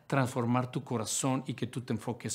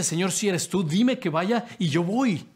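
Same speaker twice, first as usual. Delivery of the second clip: bold plea; 4 semitones higher, 5 dB louder than usual. Acted. Pedro voiced, bold request.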